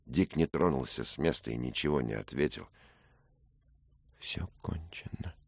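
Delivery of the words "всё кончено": hopeless whisper; girl whispering in despair. Acted. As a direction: breathe it out, all hope gone. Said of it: "сказала девушка".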